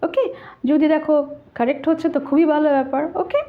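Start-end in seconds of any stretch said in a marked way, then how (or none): none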